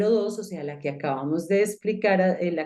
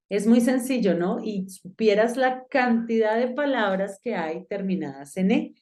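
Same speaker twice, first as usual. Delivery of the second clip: ordinary talk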